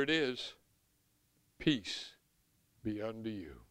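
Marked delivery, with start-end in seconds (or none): 1.6-3.6 soft, tender blessing; gentle. Retold or imitated. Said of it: "and said unto them".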